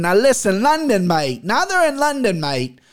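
badly attempted London accent; hammed-up, amateur English accent; horrible English accent. Shown as a demonstration. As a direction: put on a clumsy English "mate" accent. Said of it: "horrible English accent, but I'm trying".

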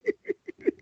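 a helpless, high-pitched giggle. Real, not acted. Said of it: "giggling like a little girl".

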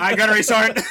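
loud, high reply through laughter; laughing. Retold or imitated. Airman replying to the sergeant, retold through laughter. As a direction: burst out laughing on the words.

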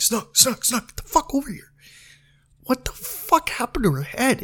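high, hushed, insistent call to a friend; urgent private summons. Retold or imitated. Calling Snook over out of her earshot, urgent and hushed. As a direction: pull him over urgently, voice high and soft.

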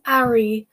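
'Arry said without its opening H; French-accented name. A narrator's reading. Teasing with the French girl's way of saying 'Arry, H dropped. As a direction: drop the H on 'Arry, French-style. Mimicking Fleur's French pronunciation.

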